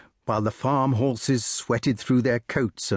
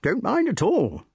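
put-on old squire voice; 10 semitones higher than usual. Sir Ector voiced in story.

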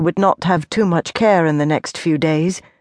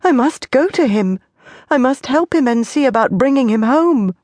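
urgent plea; 7 semitones higher than usual. A narrator's reading. Frightened wife insists.

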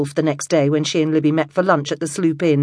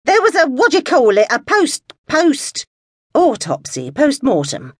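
high, louder, fumbling impatiently for the word; fussy old lady, impatient and raised; 11 semitones higher, 4 dB louder than usual. A narrator's reading. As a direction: brush past the details, a little irritated. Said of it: "The voice that was Alice sounded impatient".

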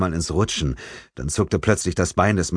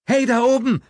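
higher voice, mildly raised call upward; 3 dB louder than usual. A narrator's reading. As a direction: call up to the staff above. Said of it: "rief Emilie".

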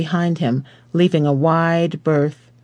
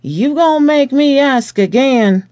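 higher, louder threat in Southern voice; menacing cop; 9 semitones higher, 5 dB louder than usual. A narrator's reading. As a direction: lean on him, impatient and menacing. Officer pressing, threatening.

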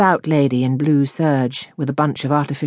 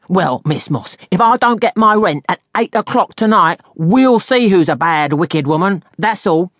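raised, forceful ultimatum; threatening landlady; 4 semitones higher, 5 dB louder than usual. A narrator's reading. Landlady threatens lodger over rent, raised angry voice. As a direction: lay down the threat indignantly.

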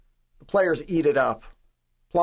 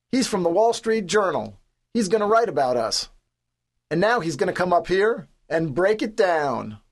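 high, projected introduction; announcing bellow; 5 semitones higher than usual. A narrator's reading. Captain presenting the writer to the team, bellowing. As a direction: build the guest up for the crowd.

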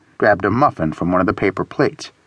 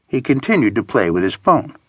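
ordinary delivery, nothing marked

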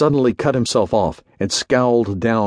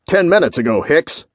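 sharp, higher-pitched accusation; snapped; 5 semitones higher, 3 dB louder than usual. A narrator's reading. General confronts his orderly with narrowed eyes, snapping. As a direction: snap it at a subordinate. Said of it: "he snapped".

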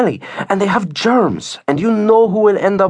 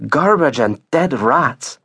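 slow, higher-pitched certainty; dead certain; 7 semitones higher, slower than usual. A narrator's reading. Lourdes judging what the dog has eaten, dead certain. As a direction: pronounce it slowly as settled fact. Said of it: "like it was for sure".

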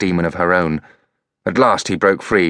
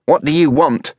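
louder, suspicious demand; hostile woman; 9 semitones higher, 3 dB louder than usual. A narrator's reading. Freya confronting Elliot, louder and suspicious. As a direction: interrogate the boy coldly.

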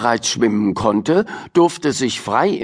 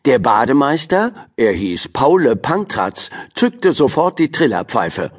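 ordinary delivery, nothing marked

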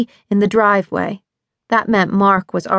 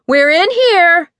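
loud, much higher, slow cheerful call-out; 10 semitones higher, 6 dB louder, slower than usual. A narrator's reading. Busy mom calls her sister into the kitchen, loud and cheerful. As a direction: sing out brightly across the kitchen. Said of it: "her sister called out, her voice full of capable good cheer".

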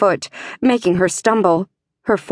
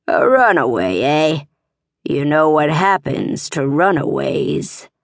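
leering stablemaster; 4 semitones lower than usual. A narrator's reading. Stablemaster to the cornered runaway, low and menacing. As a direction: savour it like a predator cornering prey.

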